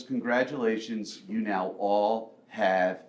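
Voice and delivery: low, slow, quiet teacher's punchline; deadpan reveal. Retold or imitated. Health teacher springing the lesson on the class in a deadpan voice. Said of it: "the woman that's teaching goes".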